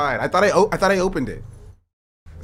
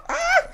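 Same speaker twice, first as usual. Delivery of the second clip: high startled yelp. Real, not acted. Startled by dog.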